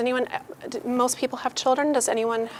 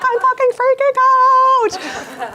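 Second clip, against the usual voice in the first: much higher, slow, strained freak-out; mock panic; 8 dB louder, slower than usual. An overworked woman confessing she is panicking, high and drawn out. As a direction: squeal the panic out slowly. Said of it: "they're like".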